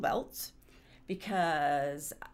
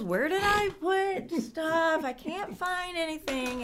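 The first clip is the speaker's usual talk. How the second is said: higher, slow, exasperated voice; frustrated self; 11 semitones higher, 4 dB louder, slower than usual. Retold or imitated. Homeowner reenacting her frustration, higher and slow. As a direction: whine it out, hunting for lost things. Acting out losing everything.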